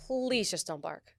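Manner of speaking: low, soft retold plea, drawn-out "please"; hushed plea. Podcast guest begging the dog not to bark, hushed plea. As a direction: quietly mimic begging under your breath.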